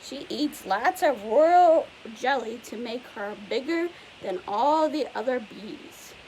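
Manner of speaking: usual speaking voice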